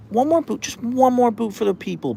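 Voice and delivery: high, cooing pet voice. Real, not acted. Owner coos at her dog asking for a boop.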